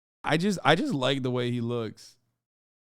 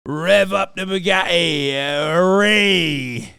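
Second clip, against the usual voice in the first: higher, louder hammed-up British geezer accent; mock British geezer; 5 semitones higher, 9 dB louder, slower than usual. Streamer hyping a Bugatti in a loud mock British accent. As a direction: shout it like a flashy British lad.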